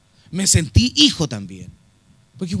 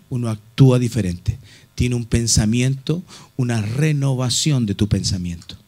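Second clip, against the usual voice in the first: preaching; 9 semitones lower than usual. Real, not acted. Low earnest preaching.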